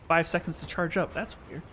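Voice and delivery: ordinary talk, nothing marked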